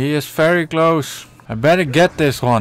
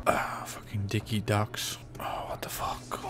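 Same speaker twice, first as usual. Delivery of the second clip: whispered swearing; 4 semitones lower, 14 dB quieter than usual. Real, not acted. Muttering at the game.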